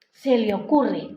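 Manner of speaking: ordinary talk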